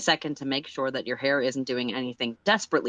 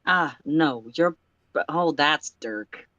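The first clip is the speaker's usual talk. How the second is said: put-on slipping into a Texan accent; accidental Texan accent; slower than usual. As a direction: let a Texan drawl creep in. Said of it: "it keeps going Texan".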